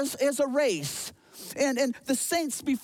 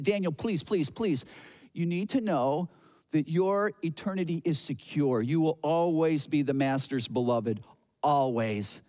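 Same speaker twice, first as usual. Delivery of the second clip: tender reassurance; 6 semitones lower than usual. Retold or imitated. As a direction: soothe a frightened friend, dropping the voice low. Angel comforting panicking Daniel.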